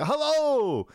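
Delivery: put-on loud, high Welsh accent greeting. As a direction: bellow a hello in a Welsh accent. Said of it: "Obviously in a Welsh accent".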